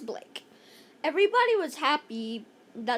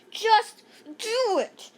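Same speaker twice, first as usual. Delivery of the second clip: high, loud, slow command; shout; 7 semitones higher, 5 dB louder, slower than usual. Acted. Andrea ordering Emily onto her shoulders, shout. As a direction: bark it as an order. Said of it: "shouted Andrea".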